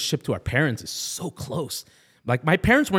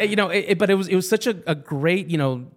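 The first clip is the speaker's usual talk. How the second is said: normal talking voice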